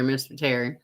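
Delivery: normal talking voice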